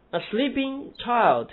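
put-on slow, sleeping and child both stressed, child falling; both words stressed. Shown as a demonstration. Teacher showing students how both words take stress. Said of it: "全部要重读".

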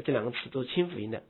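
normal talking voice

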